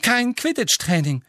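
a high, loud, hollow announcement. A narrator's reading. The captain tells the team there is no training, hollow. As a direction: drop the bad news flatly. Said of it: "sagte Angelina mit hohler Stimme".

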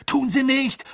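high, loud gruff contradiction; blustering shout. A narrator's reading. Ron contradicts Hermine during the dive, blustering shout. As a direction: thunder back a denial. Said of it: "polterte Ron".

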